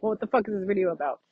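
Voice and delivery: viewer voice. Retold or imitated. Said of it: "you're like".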